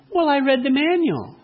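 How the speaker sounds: sweet wife. Acted. Wife answers husband who couldn't work it, sweetly. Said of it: "so sweetly and so kindly".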